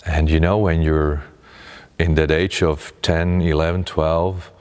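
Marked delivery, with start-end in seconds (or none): none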